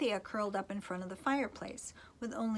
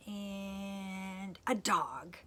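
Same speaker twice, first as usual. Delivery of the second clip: slow comic beat; much slower than usual. Acted. Comic list pause.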